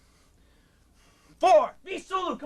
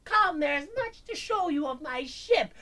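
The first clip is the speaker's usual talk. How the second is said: lightly voiced child alien host; a hint of a childlike alien; 3 semitones higher, slower than usual. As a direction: play the eerie little alien boy. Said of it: "he's going".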